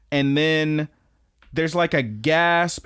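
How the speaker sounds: ordinary delivery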